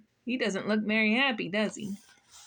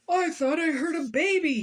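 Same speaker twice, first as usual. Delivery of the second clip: loud, higher-pitched storybook outburst; raised voice, pitched well up; agitated cry; 7 semitones higher, 5 dB louder than usual. A narrator's reading. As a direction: cry it out in alarm as the grumpy man. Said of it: "he cried".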